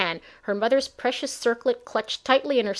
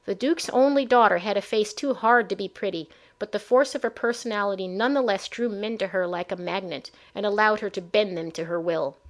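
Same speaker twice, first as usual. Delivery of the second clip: ordinary delivery, nothing marked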